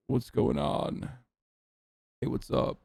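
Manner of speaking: low, breathy gravelly fry; creaky husky YouTuber intro; male vocal fry. Retold or imitated. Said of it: "called Male Vocal Fry".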